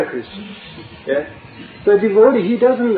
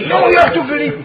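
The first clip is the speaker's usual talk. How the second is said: much higher, pressing plea; insistent doubter; 13 semitones higher, 3 dB louder than usual. Acted. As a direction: push him to believe it. Voicing the doubter in the story.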